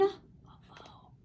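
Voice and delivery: hushed worried whisper; very soft stage whisper; whispered aside. Real, not acted. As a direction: whisper it to the listeners in suspense.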